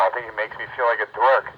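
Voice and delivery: ordinary talk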